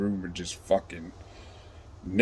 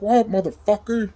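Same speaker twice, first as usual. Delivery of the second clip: much higher mock yell; irritated guard; 12 semitones higher than usual. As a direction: mimic a guard fed up with banging. Guard answers the banging.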